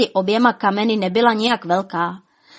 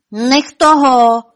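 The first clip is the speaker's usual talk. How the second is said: high, loud, drawn-out shout; 4 semitones higher, 8 dB louder, much slower than usual. A narrator's reading. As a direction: yell it out slowly and loudly. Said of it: "křičel Lumír".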